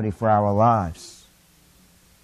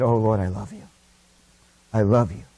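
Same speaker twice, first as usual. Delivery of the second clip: tender devotion; 6 semitones lower than usual. Pretend. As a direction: murmur it to the Lord like a private prayer. Tender words spoken to the Lord.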